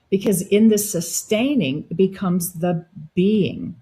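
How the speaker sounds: ordinary talk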